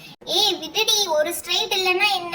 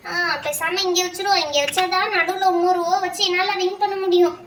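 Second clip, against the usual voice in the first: ordinary delivery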